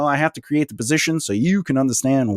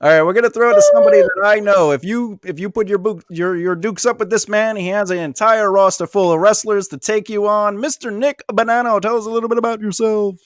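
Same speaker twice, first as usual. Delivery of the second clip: higher-pitched radio host build-up; announcer introduction; 8 semitones higher than usual. Pretend. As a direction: introduce the guest like a ring announcer.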